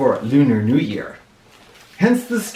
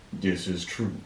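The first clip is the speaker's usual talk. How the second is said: low dragon voice; 7 semitones lower, 10 dB quieter than usual. Acted.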